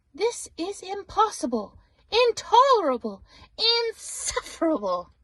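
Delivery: rising fury that fades on the last word; a bear at the end of his rope; exasperated outburst. Acted. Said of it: "cried Bear, shaking with anger".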